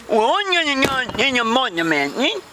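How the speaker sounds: high, garbled indignant voice; pissed-off Vicky. Acted. Vicky reacts to unequal pay, high garbled annoyance. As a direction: mangle it in a high, put-out voice.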